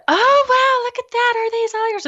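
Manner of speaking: very high, loud fawning voice; mock them with a syrupy squeal; gushing bench ladies. Mom mimicking the bench ladies' praise, very high gush. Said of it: "they said".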